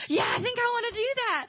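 high, thrilled agreement; imitated eager yes. Gabe committing to Jesus, high and excited.

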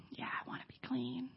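very soft, humble yes; hushed earnest answer. Retold or imitated. Ray accepting being made clean, acted very quietly and earnestly. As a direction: answer almost under your breath. Ray's retold solemn answer.

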